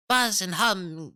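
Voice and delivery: high, loud, slow little bat voices; crying bats. A narrator's reading. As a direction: squeak it out like sleepy bats. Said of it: "they cry".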